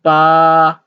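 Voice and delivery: Ba held long and level; level Mandarin tone on Ba, no fall; first tone. Shown as a demonstration. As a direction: hold Ba loud on one steady note. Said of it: "the first tone".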